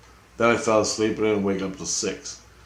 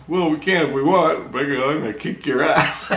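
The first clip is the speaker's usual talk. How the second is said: raised, lively mock threat; playful challenge; 7 semitones higher, 4 dB louder than usual. Christ teasing him about fighting, playful challenge. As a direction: toss out the threat, about to laugh.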